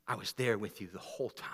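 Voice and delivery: soft, low, intimate sermon voice; hushed preaching. Acted. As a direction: draw them in with a hushed preacher's voice. Quiet intimate sermon opening.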